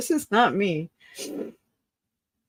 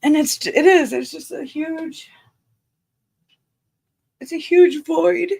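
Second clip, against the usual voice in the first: voice breaking; 6 semitones higher, 3 dB louder than usual. Real, not acted. Grieving, voice breaking.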